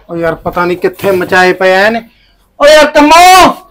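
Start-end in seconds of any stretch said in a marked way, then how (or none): 2.6-3.6 loud call across the house, high and raised. Acted. Husband hunting certificates calls out to wife, loud. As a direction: shout for Kammo to come. Calling wife from another room.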